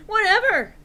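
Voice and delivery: high, loud dismissive yell; angry yell. Acted. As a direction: shout it off in disgust. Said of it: "I yelled angrily".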